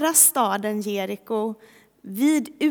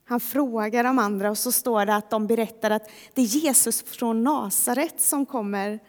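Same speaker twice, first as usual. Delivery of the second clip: normal talking voice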